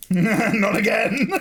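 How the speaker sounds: slow, high, laughing mock villain; Bane impression. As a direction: drag it out through laughter. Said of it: "my Bane impressions".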